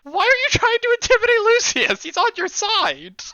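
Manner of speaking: game master stunned by a player's odd choice, incredulous; baffled disbelief. Real, not acted. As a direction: cry out in real confusion at the player.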